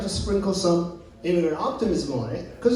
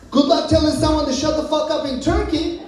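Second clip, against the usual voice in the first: ordinary talk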